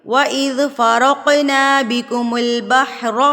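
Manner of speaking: slow chanted tajweed recitation; Quran recitation. Acted. Teacher recites the verse in slow tajweed chant. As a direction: recite it slow and melodic, drawing out vowels.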